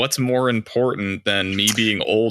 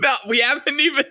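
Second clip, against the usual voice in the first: surprised; 13 semitones higher, much faster than usual. Real, not acted. Protesting spoiler warning.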